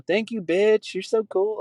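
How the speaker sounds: high-pitched gushing granddaughter; mock girly girl. Girl thanking her grandma, high mock gushing. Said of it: "like, a girl just to her grandma".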